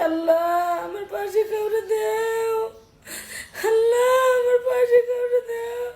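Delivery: crying prayer. Retold or imitated. Begging Allah to send someone to their side, wailing. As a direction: plead to God through real tears. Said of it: "আমি আল্লাহর কাছে কাঁদছি".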